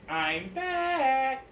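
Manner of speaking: a kind teacher greeting; sweet teacher. Acted.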